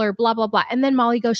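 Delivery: normal talking voice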